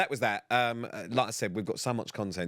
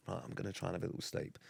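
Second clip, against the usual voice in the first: sleepy murmur; 3 semitones lower, 12 dB quieter, faster than usual. Retold or imitated. Sleepy friend quoted.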